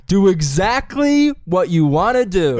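teacher's command. Retold or imitated. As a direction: push the actors, drawing it out. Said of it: "my teacher goes".

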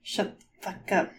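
urgent whispered hush; whispering. Real, not acted. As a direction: hiss it under your breath. Hiding from guard, hushing friend.

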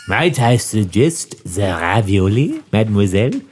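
mock French waiter, mademoiselle drawn down low; thick French accent. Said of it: "This is French".

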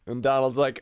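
normal talking voice

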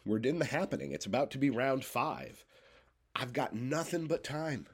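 normal talking voice

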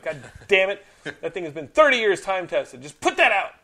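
loud exasperated rant; angry outburst. Real, not acted. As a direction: yell it out in frustration. Ranting about the franchise.

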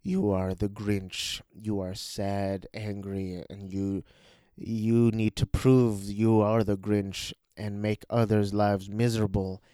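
lightly acted stern reflection; mirror Grinch. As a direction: spell it out slowly and ominously. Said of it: "the mirror Grinch is like".

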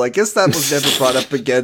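normal talking voice

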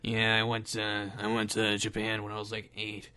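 very low, soft gruff action star; halting low, gruff mumble; Steven Seagal. Acted. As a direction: rumble quietly like a self-serious tough guy. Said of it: "in a really low, gruff voice".

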